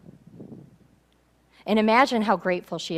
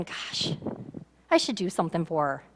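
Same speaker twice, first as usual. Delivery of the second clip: musing aside; 5 semitones lower, 7 dB quieter than usual. Pretend. Prophet thinking to himself.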